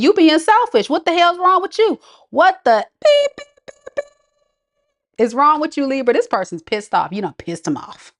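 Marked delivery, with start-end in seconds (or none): 0.0-6.1 relaying their fury, hammed up; mock outrage. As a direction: scold Libra as the upset ex. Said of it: "they said".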